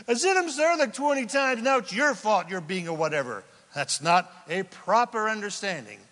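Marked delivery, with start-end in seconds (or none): 0.1-3.4 high-pitched exasperated comeback; defensive protest. Acted. Husband throws his apologies back at wife, defensive. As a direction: act out a husband fed up apologizing.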